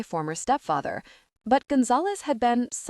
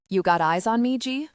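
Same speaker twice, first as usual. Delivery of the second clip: plain reading voice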